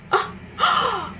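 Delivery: sudden high startled gasp; sharp gasp. Real, not acted. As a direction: catch your breath in shock. Suddenly notices something on camera.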